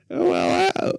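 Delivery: high, hesitant buddy voice; Ryan voice. Retold or imitated. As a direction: play him as awkward and sheepish. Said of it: "this is my Ryan voice".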